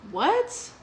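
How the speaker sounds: loud, high, rising "what"; stunned surprise. Retold or imitated. Friend hearing out of nowhere that they're getting married, stunned surprise. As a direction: blurt it out in disbelief.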